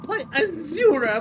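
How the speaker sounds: high, loud starstruck fan; Adoring Fan voice. Pretend. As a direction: squeal it like a gushing fanboy. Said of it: "if you wanna do an Adoring Fan voice".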